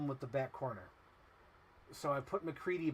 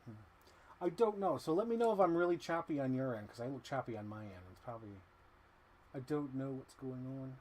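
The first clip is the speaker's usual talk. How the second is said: ordinary talk, nothing marked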